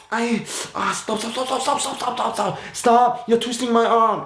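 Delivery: hammed-up whimpering plea; mock pained pleading. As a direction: pretend your arm is being twisted. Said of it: "you go".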